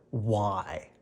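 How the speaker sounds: talking through a mouthful; mouth full. Acted. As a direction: say it around a bite of food. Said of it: "stop talking with your mouth full".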